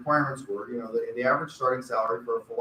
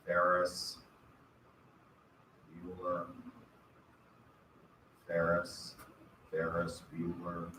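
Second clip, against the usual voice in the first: flat, droning roll-call voice; Ben Stein monotone; 7 semitones lower, 11 dB quieter, slower than usual. Retold or imitated. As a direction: call the names like a bored teacher. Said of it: "monotone voice".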